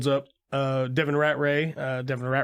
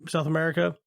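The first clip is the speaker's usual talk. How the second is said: ordinary talk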